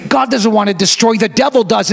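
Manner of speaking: ordinary talk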